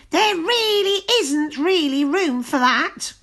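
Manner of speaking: high, loud flustered witch; cried out. A narrator's reading. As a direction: protest in a witch voice. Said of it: "cried the friendly witch".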